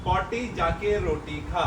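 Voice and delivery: slow, deliberate word by word; slow articulation. Real, not acted. Father gives child the second line, slowly. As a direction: dictate it slowly to someone who can't hear.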